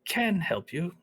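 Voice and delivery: put-on an older woman seeing through his excuse; knowing old lady. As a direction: tease him gently, then offer help. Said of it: "She says".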